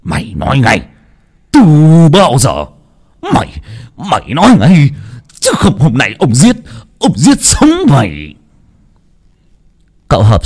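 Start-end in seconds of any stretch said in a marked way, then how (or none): 0.0-8.3 raised, threatening voice building higher; furious father demanding an answer, voice climbing; angry shout. A narrator's reading. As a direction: work up from a stern demand to a threatening yell. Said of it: "túm cổ con mà hét lên".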